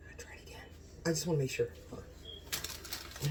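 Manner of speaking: secretive whisper, savouring; hushed whisper. Real, not acted. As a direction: whisper to yourself between bites. Tasting again, hushed.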